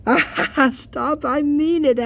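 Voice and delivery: high, laughing mock protest; high playful stop-it voice; giggly protest. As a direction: squeal it through giggles.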